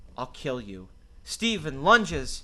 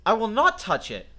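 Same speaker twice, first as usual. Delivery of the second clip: angry; 8 semitones higher, 8 dB louder than usual. Acted. Refusing cable guy at glass.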